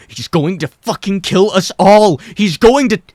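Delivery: high, loud enraged shout; furious yell. A narrator's reading. Henry yells at the others during the fight, furious and loud. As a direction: yell the threat in fury.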